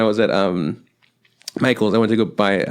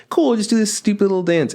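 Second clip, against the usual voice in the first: mock breezy; 10 semitones higher than usual. Mocking his own naive plan.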